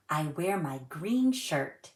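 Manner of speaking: ordinary delivery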